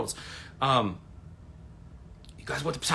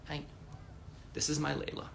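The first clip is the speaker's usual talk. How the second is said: normal talking voice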